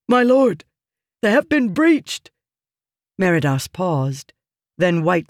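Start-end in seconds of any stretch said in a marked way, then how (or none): 0.1-2.3 high, loud urgent cry; urgent shout. A narrator's reading. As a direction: shout the news in panic. Wounded soldier reports breach.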